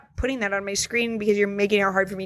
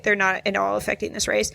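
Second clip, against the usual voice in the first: usual speaking voice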